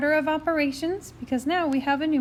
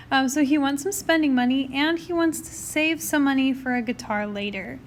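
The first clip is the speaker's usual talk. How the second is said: normal talking voice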